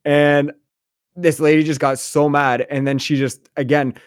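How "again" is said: normal talking voice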